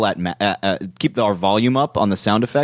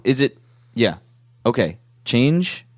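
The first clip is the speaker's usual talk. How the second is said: normal talking voice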